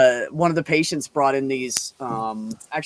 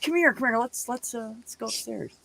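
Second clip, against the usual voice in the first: high, soft cajoling; soft, higher-pitched sweet-talk, retold; retold coaxing; 7 semitones higher, 9 dB quieter than usual. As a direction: coax an angry lover softly.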